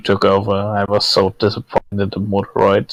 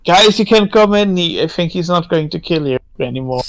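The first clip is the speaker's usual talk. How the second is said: high-pitched call to friends; 7 semitones higher than usual. Acted. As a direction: call them in, high and reassuring. Calls friends in.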